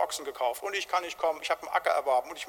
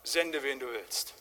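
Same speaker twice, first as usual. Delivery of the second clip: blunt refusal; 4 semitones lower, 5 dB quieter, slower than usual. Acted. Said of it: "ganz knallhart".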